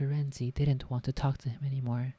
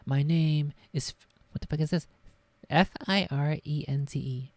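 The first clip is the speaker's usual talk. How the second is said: ordinary delivery, nothing marked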